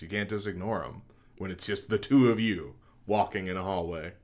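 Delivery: ordinary talk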